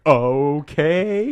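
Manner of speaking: drawn-out, loud, rising okay; stunned agreement. Retold or imitated. Fan told to sit next to Jock, stunned. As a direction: go high and questioning, as if overwhelmed.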